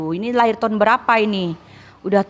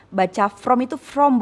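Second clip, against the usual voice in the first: ordinary talk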